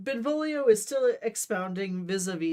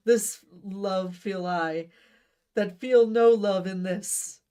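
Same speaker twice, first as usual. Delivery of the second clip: slow, louder, drawn-out recital; slow grand declaiming; 3 semitones higher, 7 dB louder, slower than usual. Acted. Romeo ending his lament to Benvolio, slow grand recital. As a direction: land the couplet like a tragic hero. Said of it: "still holding your hand out in front of you".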